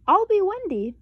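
put-on high, loud little girl; girlish voice. A narrator's reading. Sister choosing to be Wendy, high little girl voice. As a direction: claim Wendy brightly. Sister picks her role.

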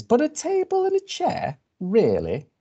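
very high, squeaky-pitched questioning; incredulous bird. Acted. Bird doubting a table and chair fit, incredulous. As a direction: shoot up high, then drop on the doubt.

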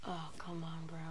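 whispered exasperation; frustrated whisper. Real, not acted. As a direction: breathe it out frustrated. Frustrated at lag.

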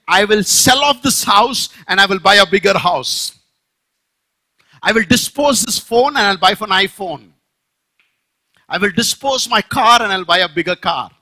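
loud boastful plans; modern rich fool boast. Acted. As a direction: brag about upgrading everything. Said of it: "That's what he said".

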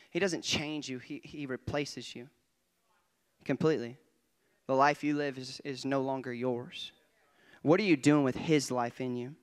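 usual speaking voice

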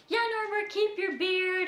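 bright cheerleading tone, imitating mom; upbeat and positive. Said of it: "and just being so positive".

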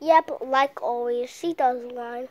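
ordinary delivery

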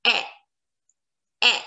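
EH as a short open vowel, as in lesson; accent grave sound. Shown as a demonstration. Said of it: "pronunciation of this accent is like".

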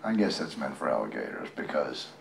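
ordinary delivery

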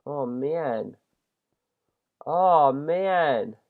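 ordinary talk, nothing marked

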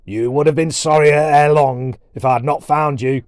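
big commanding storybook dwarf; gruff dwarf warrior. Acted. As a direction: scold like a blustering Viking.

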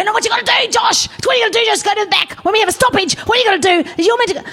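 high, fast, hammed-up ranting coach; pushy junior-footy coach voice; mock red-faced coach. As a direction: mimic an overbearing sideline dad, rushing. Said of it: "red-faced men go".

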